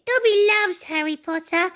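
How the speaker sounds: lightly voiced squeaky house elf; a hint of a small high elf voice; tossed-off elf voice; Dobby impression. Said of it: "the little elf with the big ears".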